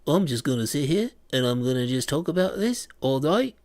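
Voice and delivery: put-on high, loud New Zealand accent; Kiwi filmmaker impression. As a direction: lampoon a New Zealand film director's voice. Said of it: "talking like what's his face".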